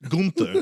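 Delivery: "Gunther" as GOON-ter, lifted like a correction; own pronunciation of Gunther. Shown as a demonstration. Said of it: "That's how I say it".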